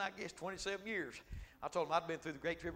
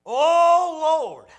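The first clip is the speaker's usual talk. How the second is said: much higher, much louder cry to God; fervent cry; 11 semitones higher, 22 dB louder than usual. Shown as a demonstration. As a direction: holler it out like at the altar.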